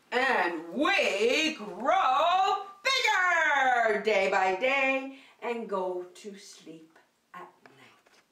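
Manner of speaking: slow sing-song rhyme with hushed moments; action poem. Acted. Teacher leads kids in a growing-flowers poem, slow sing-song. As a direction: act out the flower poem slowly.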